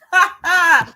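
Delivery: high, loud burst of laughter. Real, not acted. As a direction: laugh out loud at your own jibe. Laughs after own insult.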